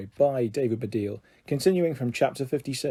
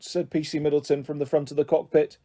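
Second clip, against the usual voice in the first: plain reading voice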